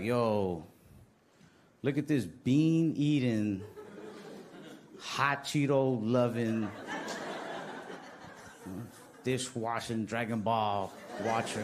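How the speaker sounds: slow, drawn-out mock insult, laughing through it; mocking heckler. Stranger taunting the comic on the street, slow laughing mock voice. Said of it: "somebody came up to me and was like".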